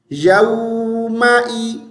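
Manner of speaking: यौमाई slow, with held oo and clear ee; correct vowels. Shown as a demonstration. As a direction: draw out यौमाई as "yauu-ma-ee". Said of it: "ई की आवाज़ है".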